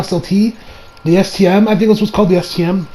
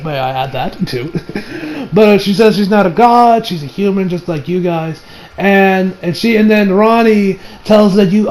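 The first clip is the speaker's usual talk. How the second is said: normal talking voice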